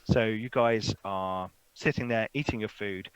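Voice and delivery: normal talking voice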